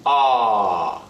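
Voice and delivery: "ah" falling like a yawn; sighing downward "ah"; fourth tone. Shown as a demonstration. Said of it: "as if you are yawning".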